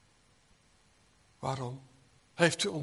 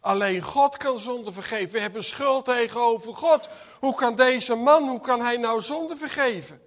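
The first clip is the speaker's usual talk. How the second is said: rising, louder, much higher protest; indignant objection; 6 semitones higher, 11 dB louder than usual. Acted. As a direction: let Simon's outrage build. Simon objects in outrage. (verontwaardigd, luider en hoger)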